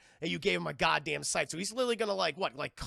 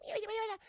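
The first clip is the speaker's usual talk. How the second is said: cute creature meows; 3 dB quieter, faster than usual. Retold or imitated. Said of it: "They're like".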